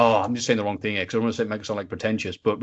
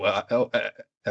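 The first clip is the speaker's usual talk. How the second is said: normal talking voice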